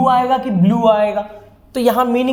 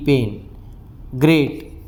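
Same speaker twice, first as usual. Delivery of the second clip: ordinary talk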